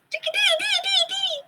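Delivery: very high sung chirp; chickadee call. Acted. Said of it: "Mister Chickadee".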